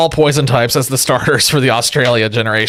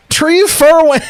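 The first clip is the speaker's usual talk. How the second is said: mock Australian; 14 semitones higher, 4 dB louder, slower than usual. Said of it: "The professor's like".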